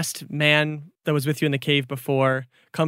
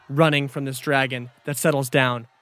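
ordinary talk, nothing marked

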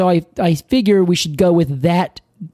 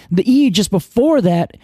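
ordinary delivery

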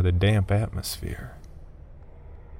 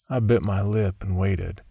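plain reading voice

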